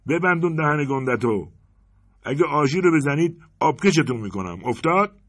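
high, loud threatening yell; robber's shout. Acted. Robber with a pistol yelling threats at the bank, loud and menacing. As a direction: bark threats at the whole bank. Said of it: "داد زد".

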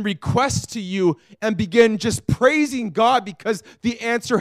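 loud, aching plea to God; impassioned pleading prayer. Shown as a demonstration. Preacher models an anguished prayer for the congregation, loud and pleading. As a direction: cry out How long to God. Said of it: "say, God, I'm frustrated".

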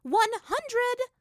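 very high, loud command; shouted order. A narrator's reading. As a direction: cut off the objection forcefully. Sister doubles the punishment.

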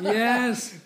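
loud high laughing cheer; excited yes. Retold or imitated. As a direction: shout it with glee. Repeats gleeful cheer.

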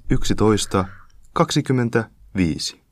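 slow, loud public-address announcement; train station announcer. Shown as a demonstration. Showing off his voice to the co-host, station announcer. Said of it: "Anna joku".

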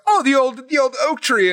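a hammed-up impression, pitched far above the teller's own voice; imitating Kate. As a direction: chirp it out like an over-keen player in character. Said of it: "being in character and stuff".

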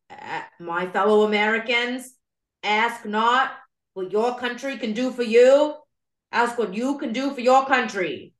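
put-on old Massachusetts presidential accent, oratorical; John F. Kennedy. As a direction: declaim it in a broad old-time Boston accent. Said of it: "he did have an insane accent".

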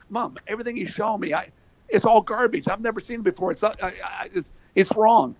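put-on teen son griping to mom; whiny teen. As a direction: complain to mom like a frustrated teen. Kid complaining to mother.

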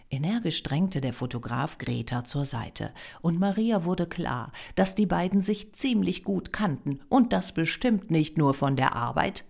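ordinary delivery

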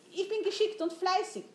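high-pitched, keen self-promotion; eager assurance. A narrator's reading. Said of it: "versicherte Jan".

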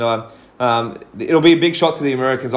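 ordinary talk, nothing marked

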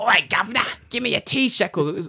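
exaggerated street-robber yell, vaguely Australian; Australian-sounding mugger. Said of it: "sounded very Australian".

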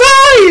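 high, loud shriek of shock; mock crowd scream. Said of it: "everyone goes".

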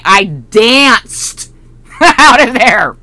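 slow, laughing through the words. Real, not acted. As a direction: laugh it out, savouring the joy. Gleeful retelling of dancing out.

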